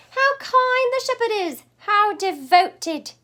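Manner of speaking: high, loud, gushing praise; gushing admiration. Acted. Pig admiring the shepherd, high loud gushing. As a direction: swoon over the kind shepherd.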